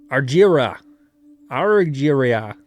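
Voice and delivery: put-on Yorkshire accent, a light touch on Argira; lightly voiced broad Yorkshire. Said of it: "in a broad Yorkshire accent".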